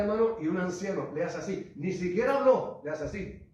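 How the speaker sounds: loud, high, fervent preaching; preaching at full force. Acted. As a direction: drive the testimony to its peak. Climax of vision testimony. (predicación a todo pulmón, en voz alta)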